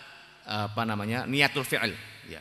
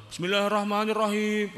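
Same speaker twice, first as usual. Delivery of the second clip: high, raised chanting prayer voice; loud, blaring recitation; 10 semitones higher, 3 dB louder than usual. Shown as a demonstration. Teacher mocking needlessly loud prayer recitation, high and blaring. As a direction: belt it out high as one held line. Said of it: "ora perlu kowe sholat mbek gembor-gembor".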